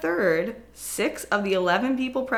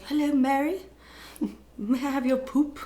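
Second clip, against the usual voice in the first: polite investigator; 5 semitones higher than usual. Acted. Acting investigator's awkward polite request.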